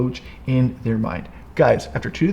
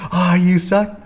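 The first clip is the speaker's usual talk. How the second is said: higher, mildly mocking jeer; mock berating; 5 semitones higher, 4 dB louder, slower than usual. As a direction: imitate someone berating you, pitched up. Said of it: "just berate me and be like".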